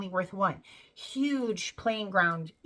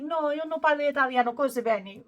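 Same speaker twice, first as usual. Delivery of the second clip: Italian phrase; 5 semitones higher than usual. Retold or imitated. Tourist answers the stranger in shaky Italian, Italian pronunciation. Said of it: "Which means I don't speak very well".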